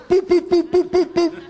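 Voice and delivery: playful knocking sounds; knocking noises. Retold or imitated. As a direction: mouth knocking boop boop. Knocking on the door at night.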